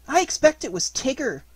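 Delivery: high-pitched nervous squeak; timid little animal voice; nervously. A narrator's reading. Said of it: "said Piglet nervously".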